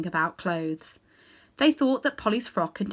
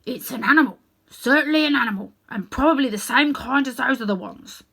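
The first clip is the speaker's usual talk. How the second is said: loud, high bulldog voice with bark in it; loud bulldog growl; gruff bulldog; 4 semitones higher, 5 dB louder than usual. Acted. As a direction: bark out the verdict like a proud bulldog. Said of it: "raised its head and said".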